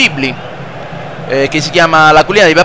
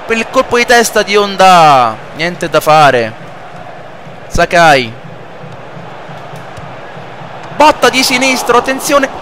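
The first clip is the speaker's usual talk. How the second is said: live play-by-play; 3 semitones higher than usual. Acted. Plays football video game commentating.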